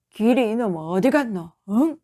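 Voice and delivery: put-on loud drunken call for his son; drunk father shouting. A narrator's reading. Drunk father bursts in shouting for his son, loud. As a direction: bellow the boy's name as you stumble in. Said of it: "하고 소리를 질렀다".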